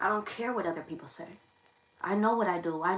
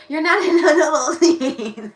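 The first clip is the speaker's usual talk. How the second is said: breaks into loud laughter, higher; laughing mid-line; 7 semitones higher, 13 dB louder than usual. Real, not acted. Streamer cracking up at her own Bikini Bottom joke, laughing. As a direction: laugh through the words. Joking about cartoon islands.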